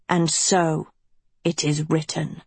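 low, fading sinister voice; ominous villain. A narrator's reading. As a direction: pronounce her fate darkly. Villain schoolmaster pronounces doom.

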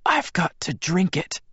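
high, faint woman's voice; barely whispered, high. A narrator's reading. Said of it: "she whispered".

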